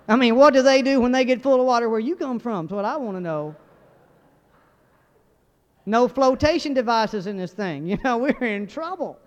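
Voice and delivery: loud, hammed-up complaint; indignant protest. Disciple challenging Jesus in the flooding boat, loud mock indignation.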